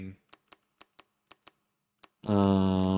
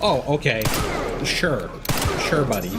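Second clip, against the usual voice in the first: sarcastic; 4 semitones higher, 3 dB louder than usual. Real, not acted. Sarcastic reaction to game event.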